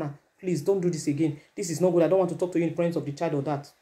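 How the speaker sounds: soft, low, pleading with the wife; acted hushed, tactful request; gentle private correction.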